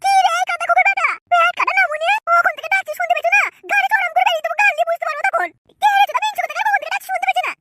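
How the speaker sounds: angry scolding. Acted. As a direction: scold the servants for not answering. Character scolds and threatens servants.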